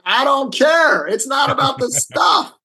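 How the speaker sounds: mock-exasperated shout at his wife; retold exasperated outburst.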